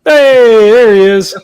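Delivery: loud, high, drawn-out "hey"; calling out. Real, not acted. Greeting the friend who just came back, calling out. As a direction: holler a welcome across the room.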